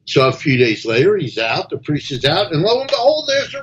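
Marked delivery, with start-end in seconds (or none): none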